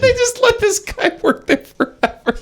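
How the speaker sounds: very high, laugh-choked voice; helpless real laughter through the words. Real, not acted. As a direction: say it while cracking up. Laughing at the story.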